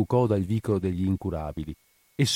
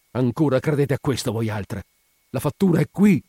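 higher, exasperated retort mounting in force; flash of anger; 5 semitones higher, 4 dB louder than usual. A narrator's reading. Spina scorning the widow's talk of spells, flash of anger. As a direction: fire back, peaking on the last phrase. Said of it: "ebbe un moto di collera".

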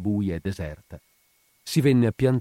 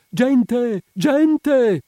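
very high, loud cry for help; calling out in alarm; 8 dB louder than usual. A narrator's reading. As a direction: shout into the square to rouse the neighbours. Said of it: "La vedova di sotto la sua porta gridava". (grido d'allarme, acuto e prolungato)

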